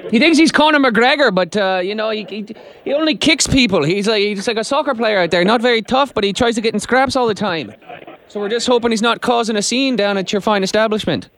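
lightly Irish prank caller voice; Irish accent. Pretend. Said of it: "Jim is gonna put on his best Irish accent".

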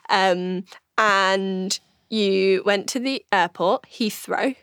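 ordinary talk, nothing marked

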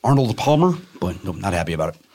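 thick South Side Chicago accent. Shown as a demonstration. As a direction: lean into the Chicago vowels. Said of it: "my dumb South Side Chicago accent".

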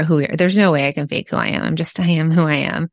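ordinary talk, nothing marked

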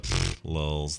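low, slow, lazy dismissive puff; half-bothered mock laugh-word, dropped low; lazy sarcastic. Shown as a demonstration. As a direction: toss it off lazily with sarcasm. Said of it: "like a lazy sort of".

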